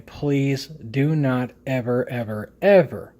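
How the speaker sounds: slow, drawn-out ever ever ever, pressing each "ever" harder; emphatic plea. Real, not acted. Warning viewers never to hot-plug gear, slow emphatic pleading. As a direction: beg them, stretching "do" and hammering each "ever".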